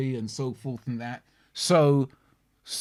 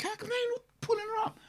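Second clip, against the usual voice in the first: high, quick and soft disbelief; incredulous inner voice; 8 dB quieter, faster than usual. Retold or imitated. Listener puzzling over the friends' silence, incredulous. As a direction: think it aloud, high-pitched and baffled.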